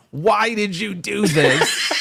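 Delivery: put-on high, slow, whiny kid voice; upset little girl. Father's crying daughter in the car, imitated high and whiny. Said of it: "I can't even replicate, like, the accent that she used".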